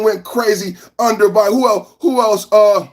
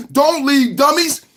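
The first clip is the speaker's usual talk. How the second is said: taunting; 6 semitones higher than usual. Acted. Taunting viewers leaving stream.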